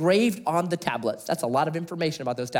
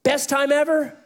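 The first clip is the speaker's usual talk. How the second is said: much higher, louder, lifted question; bright high; 11 semitones higher, 4 dB louder than usual. Shown as a demonstration. Preacher setting up the contrast, bright and high. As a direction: toss it up brightly. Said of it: "best versus worst contrast".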